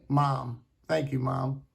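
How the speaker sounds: ordinary talk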